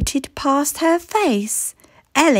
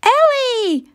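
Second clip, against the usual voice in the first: put-on children crying out; 12 semitones higher than usual. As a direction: yelp her name like a kid. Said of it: "cried the children".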